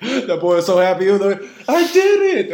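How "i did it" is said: very high, loud squeal of victory; imitated triumphant yell. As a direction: cry out like an overjoyed kid. Voicing the player's happy cry.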